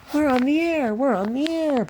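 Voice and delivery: high, chugging little engine chant; puffing Peter Sam. A narrator's reading. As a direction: puff it out high and proud. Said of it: "puffed Peter Sam".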